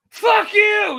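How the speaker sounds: very high, loud holler; comic long-range shout; yelled insult. Retold or imitated. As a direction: hurl it across the distance. Retold shouting match between gamers.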